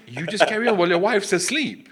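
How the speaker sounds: high, loud appalled question; scandalised disbelief. Retold or imitated. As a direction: act out the friends' outraged astonishment. Retold friends appalled by answer.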